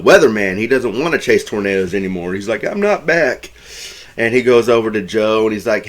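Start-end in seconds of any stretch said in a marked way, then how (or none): 2.6-3.5 higher-pitched but unforced mock protest; milder denial. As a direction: repeat the objection lightly, imitating him. Same denial retold more softly.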